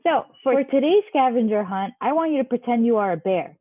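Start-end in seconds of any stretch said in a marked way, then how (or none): none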